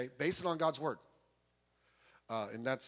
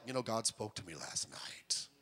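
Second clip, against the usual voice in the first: whispered mock revelation; hushed imitation of a charismatic; 7 semitones lower, 9 dB quieter than usual. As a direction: breathe it like a secret vision. Mocking charismatics' claims.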